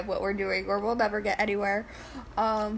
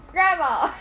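calling out; 5 semitones higher, 8 dB louder than usual. Real, not acted. Calling out to grandma.

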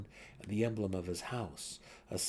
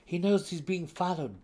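higher, louder sharp remark; Dinin voice; 9 semitones higher, 8 dB louder than usual. A narrator's reading. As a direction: say it pointedly. Dinin signals to Brisa.

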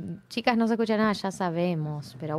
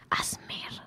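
soft breathy ASMR whisper; 10 dB quieter than usual. Shown as a demonstration. Naming ASMR videos to her co-host, in a whisper. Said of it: "¿cómo se llaman estos videos que?".

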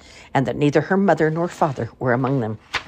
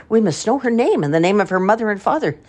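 village women; 6 semitones higher, 3 dB louder than usual. A narrator's reading. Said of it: "said the women".

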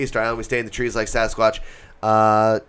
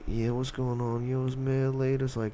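soft, slightly dragged lifeless intro; mocking co-host impression; 10 dB quieter than usual. Mocking the co-host's solo episode intro, soft and dull.

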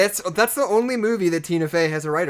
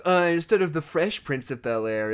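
usual speaking voice

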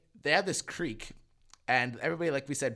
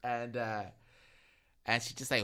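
ordinary talk

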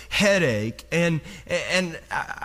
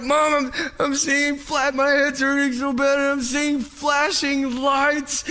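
panicked; 7 semitones higher than usual. Retold or imitated. Panicked teen calls to mom.

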